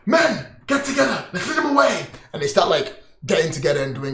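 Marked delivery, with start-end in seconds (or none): 0.0-2.0 commanding officer. Acted. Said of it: "It's like".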